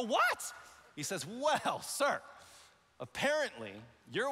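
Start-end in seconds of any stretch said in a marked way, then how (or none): 0.0-0.5 high, sharp rising what; startled what. Retold or imitated. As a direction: jump on it in alarm. Reacting to phone call.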